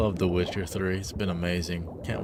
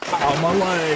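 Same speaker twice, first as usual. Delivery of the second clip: mock pain; 8 semitones higher, 5 dB louder, slower than usual. Joking about breaking a leg.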